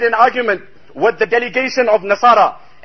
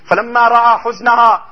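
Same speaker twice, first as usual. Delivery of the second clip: fast Arabic recitation; 4 dB louder, much faster than usual. Real, not acted. Hadith quoted in Arabic.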